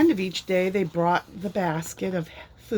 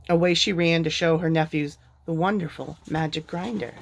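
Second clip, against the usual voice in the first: plain reading voice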